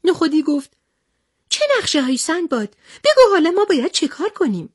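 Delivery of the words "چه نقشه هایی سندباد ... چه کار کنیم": high, hurried, anxious character voice; worried Nokhodi. Acted. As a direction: pipe up urgently, pitched right up. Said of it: "همهٔ افراد نگران شدند".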